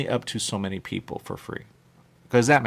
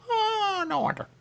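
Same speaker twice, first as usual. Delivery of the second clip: mock wail; slower than usual. Realizing the restaurants share owners.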